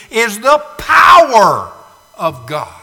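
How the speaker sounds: loud, slow cry on power; preacher shouting the power of God; shouted climax. Acted.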